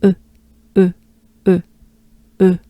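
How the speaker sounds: "E" as rounded "euh"; French e sound. Shown as a demonstration. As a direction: push lips forward for French "E". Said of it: "It's forward".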